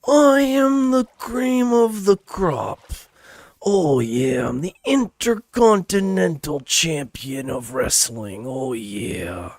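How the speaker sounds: loud, high, slow wrestler promo; hammed-up wrestling brag, easing down at the end; Randy Savage. Shown as a demonstration. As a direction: draw it out with a wrestler's strain. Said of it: "my favorite impression to do".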